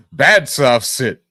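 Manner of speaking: slow mock scolding. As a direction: drag it out like scolding a pet. Scolding like a dog.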